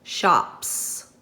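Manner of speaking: "Shops." with a drawn-out hissing S at the end; stretched hissing S. Shown as a demonstration. Teacher stretches the word so pupils hear the S. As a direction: hold the final S of "Shops." like a hiss. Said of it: "the S sound or the Z sound on the end?".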